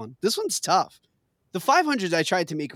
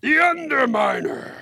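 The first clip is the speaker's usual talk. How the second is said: villain voice; 9 semitones higher than usual. Acted. Host echoing the answer as the villain Underminer, mock booming. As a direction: announce the villain with mock grandeur. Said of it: "That was a good Underminer".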